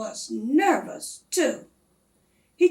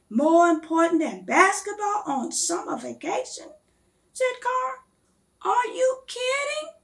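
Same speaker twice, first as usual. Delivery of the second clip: incredulous; 8 semitones higher, 4 dB louder than usual. A narrator's reading. Carl disbelieving in read story.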